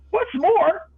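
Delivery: high, loud snap; snapping Lorax. A narrator's reading. The angry Lorax cutting in on the Once-ler, in a high loud snap. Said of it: "snapped the Lorax".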